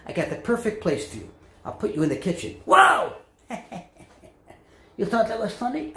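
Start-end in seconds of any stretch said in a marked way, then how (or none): 2.6-3.1 high, loud cry of alarm; comic shriek; startled yelp. Acted. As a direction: yelp in sudden horror. Turkey startles at the kitchen.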